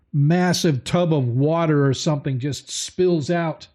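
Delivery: normal talking voice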